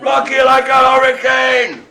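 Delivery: loud, high holler of a song line; rock shout. Acted. Host yelling a song line at the players, rowdy shout. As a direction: belt it out like a rock frontman.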